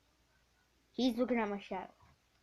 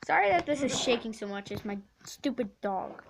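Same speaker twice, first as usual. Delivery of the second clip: ordinary delivery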